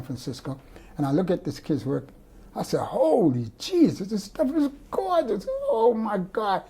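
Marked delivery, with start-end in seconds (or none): 2.8-6.6 much higher, slow amazed exclamation; retold amazement. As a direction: gasp it out, dazzled by the paintings. Amazed on seeing student's paintings.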